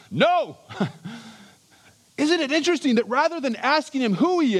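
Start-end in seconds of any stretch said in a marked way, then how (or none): 0.1-0.6 a short, high, punched No; emphatic curt no. Shown as a demonstration. John cutting off the questioners with a curt, emphatic No. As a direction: bite off No. in one clipped syllable. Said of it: "just an emphatic, curt".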